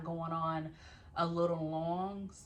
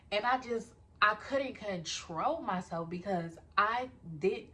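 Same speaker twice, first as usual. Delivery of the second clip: usual speaking voice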